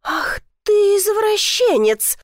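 high, drawn-out indignant cry; outraged accusation. A narrator's reading. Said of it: "не унималась она". (возмущённый крик)

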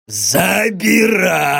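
very slow, gleeful demon shout; greedy joyful demon. Acted. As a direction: drag it out with hungry delight. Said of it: "прозвучал радостно и жадно". (радостный и жадный голос демона)

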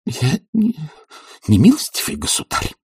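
high-pitched, halting protest; indignant mumble. A narrator's reading. Blond visitor objects to being addressed formally, mumbling indignantly. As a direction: stumble into an affronted objection. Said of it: "возмущенно пробормотал блондин".

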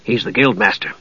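soft, discreet aside; hushed explanation; undertone. A narrator's reading. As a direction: confide it quietly. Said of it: "said Dugan in an undertone".

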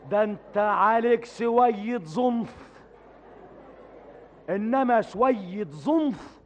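slow, heavily nasal speech. Acted. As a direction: talk through the nose, slowly. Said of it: "انت بتتكلم بالنون ليه؟".

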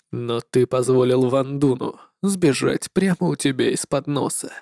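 low, soft cold reproach; icy shadow lord. Acted. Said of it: "холодно заявила тень".

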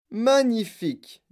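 loud, high, drawn-out exclamation; enthousiasme. A narrator's reading. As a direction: relish the word slowly and loudly. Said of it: "dit monsieur Wonka". (exclamation enthousiaste, forte et étirée)

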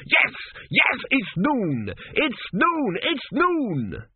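high-pitched commentary climbing to a frenzy; live play-by-play building to triumphant cries; excited sports commentator. A narrator's reading. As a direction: call the final moments like a winning goal. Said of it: "shouting into that bulrush".

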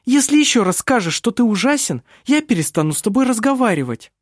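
a hint of a young woman, gently. A narrator's reading. Vinga scolds, high.

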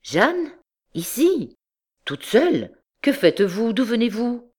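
stunned questions, rising high; startled, swooping high pitch; astonished. A narrator's reading. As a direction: question her in amazement. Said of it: "Il s'arrêta stupéfait".

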